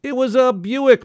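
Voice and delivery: high-pitched, emphatic contradiction; bellowed retort. A narrator's reading. Uncle contradicting Izzy about the car, bellowed. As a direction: bellow back in an argument. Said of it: "my Uncle Shecky bellowed".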